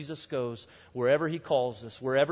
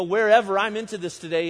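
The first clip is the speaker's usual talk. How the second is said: eager shout; 4 semitones higher, 7 dB louder than usual. Retold or imitated. Eager pledge to follow Jesus.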